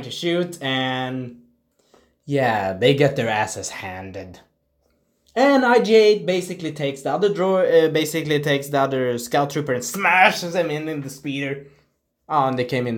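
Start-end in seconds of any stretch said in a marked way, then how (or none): none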